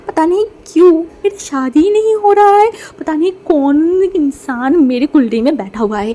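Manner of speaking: whiny complaint about fate; mock lament. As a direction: wail over bad luck, half joking. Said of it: "motion वो देखने बोला".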